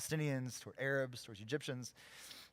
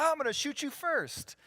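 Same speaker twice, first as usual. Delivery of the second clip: high, loud hammed-up tough-guy boast; mock trigger-happy volunteer; 14 semitones higher, 6 dB louder than usual. Imagined group member mocking the soldier's expected answer, hammed-up and much higher. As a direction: play the eager gunslinger for a laugh. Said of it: "somebody's gonna be like".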